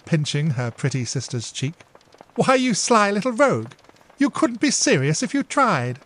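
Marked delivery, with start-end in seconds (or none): 2.3-6.0 higher, louder playful big-sister voice; playful older sister teasing. Acted. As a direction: tease her fondly. Emily teases her sister.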